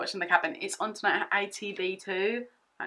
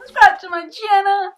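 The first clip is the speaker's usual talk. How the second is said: much higher and louder, yelled out; shouted plug; 12 semitones higher, 15 dB louder than usual. Shown as a demonstration. Urging viewers to subscribe, a loud shouted plug. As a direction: belt the channel plug. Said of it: "If you want to subscribe".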